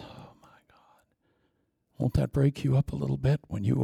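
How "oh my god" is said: breathless hush; awed whisper. Real, not acted. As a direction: let the shock slip out softly. Awed aside.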